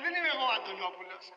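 fast, sly, low quip; sly punchline. Retold or imitated. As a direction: toss it off as a clever trickster. (πονηρή γρήγορη ατάκα)

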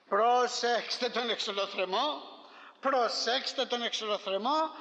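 slow, emphatic preacher's warning; preaching. Acted. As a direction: drive the warning home, slowly and firmly. Preacher warns the congregation. (κήρυγμα με έμφαση, αργά)

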